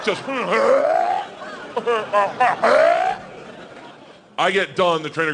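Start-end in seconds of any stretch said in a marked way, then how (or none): 0.3-3.5 high bear snarling and huffing; bear noises. Acted. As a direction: imitate a greedy bear grunting. Imitates bear mouthing the marshmallow.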